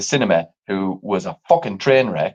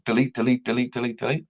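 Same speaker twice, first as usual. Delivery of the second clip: muttering; 8 dB quieter than usual. Retold or imitated. Deleting dating apps.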